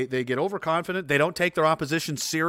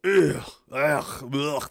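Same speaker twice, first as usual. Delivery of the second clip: gross Scottish throat noises; a failed Scottish accent of guttural grunts; bad Scottish accent; much slower than usual. Shown as a demonstration. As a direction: attempt a Scottish accent and fail. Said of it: "I can't do a Scottish accent. I just make gross noises".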